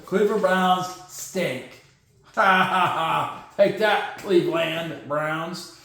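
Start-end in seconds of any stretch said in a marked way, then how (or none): 2.4-3.4 mock triumphant ha-ha-ha; staged gloating cackle; gloating laugh. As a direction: laugh deliberately at a rival, descending. Gloating at the Browns.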